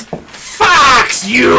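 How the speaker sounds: shouting. Pretend. Joke poster slogan shouted.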